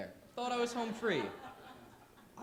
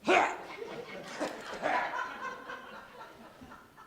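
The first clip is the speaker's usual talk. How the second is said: choking gasp; 3 dB louder than usual. Retold or imitated. Cousin chokes on retainer.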